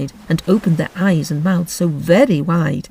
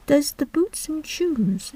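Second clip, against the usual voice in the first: higher, softer, slow puzzled echo; wondering; 9 semitones higher, 5 dB quieter, slower than usual. Acted. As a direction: wonder aloud, baffled. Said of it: "in a wondering tone".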